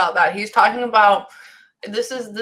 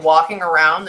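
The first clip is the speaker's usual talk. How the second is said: usual speaking voice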